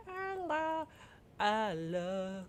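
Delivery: very high, far-off squeaky voice; gushing squeal. Acted. Streamer gushing over the beloved Stegosaurus, high squeal. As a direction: squeak it out with love.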